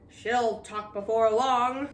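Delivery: slow menacing voice through gritted teeth; through ground teeth. Acted. Captain swearing she will talk, through gritted teeth. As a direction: grind it out, slow. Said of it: "ground his teeth and said".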